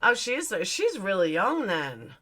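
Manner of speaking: normal talking voice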